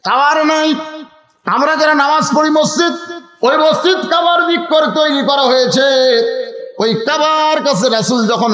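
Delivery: high, chanted sermon delivery; full-force preaching. Real, not acted. Preacher urging the congregation to pay attention, full-force preaching.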